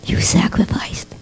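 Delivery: soft unvoiced whisper; reverent whisper. Rebbetzin honouring the wounded soldier, whispered. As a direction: let it fall to a hush.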